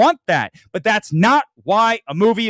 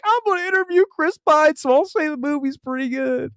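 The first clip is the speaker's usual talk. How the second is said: mock critic voice; 8 semitones higher than usual. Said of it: "Katie was like".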